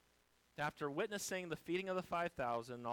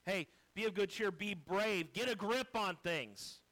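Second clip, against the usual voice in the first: louder, blunt pep-talk tone; brusque buck-up; 6 semitones higher, 6 dB louder than usual. Shown as a demonstration. Jesus misread as telling disciples to get a grip, blunt. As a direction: snap them out of it bluntly. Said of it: "they're not called there, "Hey, what's wrong with you?".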